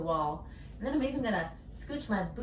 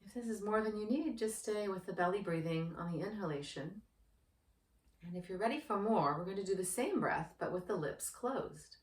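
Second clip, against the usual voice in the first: soft, unhurried meditation guide voice; guided meditation; 2 semitones lower, 6 dB quieter than usual. Acted. Yoga teacher guides anxious viewers through breathing, in a soft meditation voice. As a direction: ease the listener into calm breathing.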